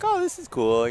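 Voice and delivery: way up high, mock-thrilled oh; mock impressed. Runner recalling first local meets, mock delighted and high. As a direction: imitate a kid wowed at a local meet. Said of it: "and you're like".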